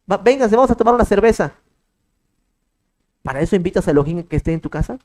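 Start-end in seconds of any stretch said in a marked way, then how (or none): 0.1-1.5 higher, louder, carefree party host; mock carefree invitation; breezy casual invite. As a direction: toss it off like inviting a buddy for a beer. Host casually inviting God to drink.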